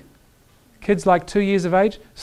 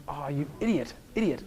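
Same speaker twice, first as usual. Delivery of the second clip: soft, low self-scolding mutter; mock yourself quietly for being naive; muttered self-mockery; 5 semitones lower, 13 dB quieter than usual. Telling the story of meeting Bobby, chides himself under breath. Self-mocking retold reaction.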